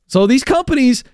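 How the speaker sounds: usual speaking voice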